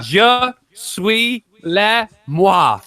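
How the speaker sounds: very slow, exaggerated French counting; hammed-up French in place of one two three; slow mock French. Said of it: "but I'm gonna do it in French".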